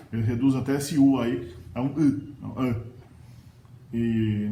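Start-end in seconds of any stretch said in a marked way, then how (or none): none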